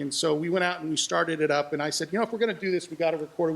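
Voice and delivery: normal talking voice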